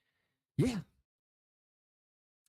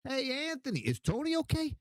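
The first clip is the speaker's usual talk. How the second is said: higher mock question; imitating people asking; 12 semitones higher than usual. Strangers asking about Tony, higher mocking voice. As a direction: ask it pitched up, mocking. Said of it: "you don't know how many times".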